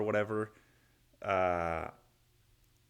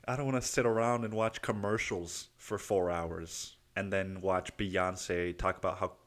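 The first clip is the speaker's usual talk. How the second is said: ordinary talk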